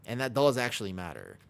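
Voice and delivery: ordinary talk, nothing marked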